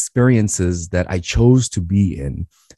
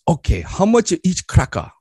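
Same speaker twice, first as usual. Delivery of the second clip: put-on lightly accented broken English of an immigrant dad; Korean dad accent; 10 semitones higher than usual. Dad instructs son to price crackers, lightly accented. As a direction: play the dad giving his son blunt orders.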